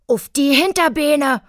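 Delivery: hoch und laut gekreischt; kreischend. A narrator's reading. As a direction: kreisch die Herausforderung heraus. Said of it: "kreischte Billy". (lautes, hohes Kreischen)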